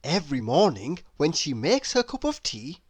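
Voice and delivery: ordinary delivery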